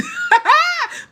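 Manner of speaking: a sudden high squealing laugh; high giggle. Real, not acted. Laughing at her own joke about filtered skin, squealing giggle.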